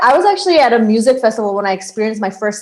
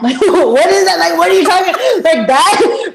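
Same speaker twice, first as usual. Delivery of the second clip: high, giggly mock bafflement; laughing disbelief; 8 semitones higher than usual. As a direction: retell the bewilderment through laughter. Acting out stunned reaction, laughing.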